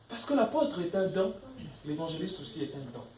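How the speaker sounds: ordinary talk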